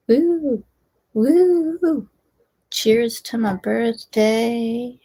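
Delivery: high-pitched woos, then a drawn-out toast; whoop, then stretch out the birthday toast; celebratory cheer. Real, not acted. Meditation host cheers own birthday to the group, celebratory.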